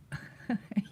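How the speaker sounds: real short, breathy chuckle; amused laugh. Real, not acted. Storyteller about to reveal deleted video, amused laugh. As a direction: chuckle before giving away the punchline.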